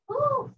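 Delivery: high joyful woo; excited whoop. Real, not acted. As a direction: cheer it out high. Instructor cheering mid-workout.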